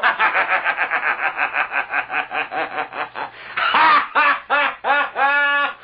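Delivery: a long belly laugh at his victim; long hearty laugh. Acted. Prankster laughs at the scared patient. (carcajada larga y burlona)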